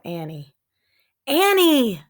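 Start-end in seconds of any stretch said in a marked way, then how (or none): 1.2-2.0 loud, higher call; shouting. A narrator's reading. Jack calling for his lost sister, loud shout. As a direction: call her name into the woods. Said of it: "he shouted again".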